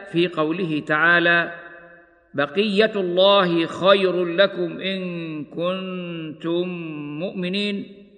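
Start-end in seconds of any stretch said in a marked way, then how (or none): 2.3-8.1 slow, measured tartil chant; slow Quranic recitation. Acted. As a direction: recite it slowly with tajweed. Recites verse in tajweed style.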